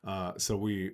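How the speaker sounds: ordinary talk, nothing marked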